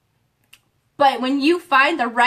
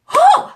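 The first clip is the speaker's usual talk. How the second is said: relieved whew; 9 dB louder than usual. Real, not acted. Exhales after fired-up speech.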